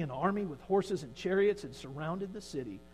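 ordinary talk, nothing marked

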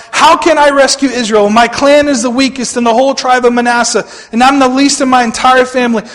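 high, loud objection; protesting Gideon. Acted. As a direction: protest your own weakness, voice pushed up. Gideon protests his weakness to Lord.

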